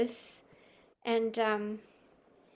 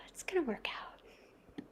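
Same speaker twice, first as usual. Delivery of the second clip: whispering; 3 semitones higher, 12 dB quieter, slower than usual. Acted. Whispered reassurance.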